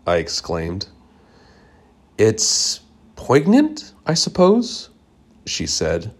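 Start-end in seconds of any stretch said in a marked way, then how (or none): 2.2-4.9 lightly voiced aunt, high and slow; a hint of a dreamy old aunt; musing aunt. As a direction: drift up, slow and wistful. Aunt's musing reply.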